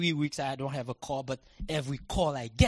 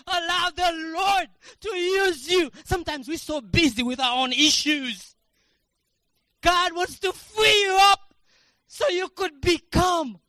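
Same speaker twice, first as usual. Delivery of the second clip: loud, high fervent preaching shout; preaching at full force; 12 semitones higher, 14 dB louder than usual. Acted. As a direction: cry it out to the congregation, slow and loud.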